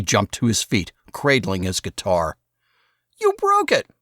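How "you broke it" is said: put-on high, loud anguished kid cry; Saul, outraged. A narrator's reading. Saul yells at his brother over the guitar, high and loud. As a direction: wail it like a boy whose guitar just cracked. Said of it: "He swore at me".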